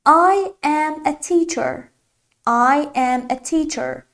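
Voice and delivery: very slow and clear; slow teacher demo; slow model sentence. Shown as a demonstration. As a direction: say each word slowly for learners.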